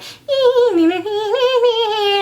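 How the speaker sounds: high, squeaky sung violin line; imitated violin. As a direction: mimic a violin with the voice. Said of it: "play some violin music".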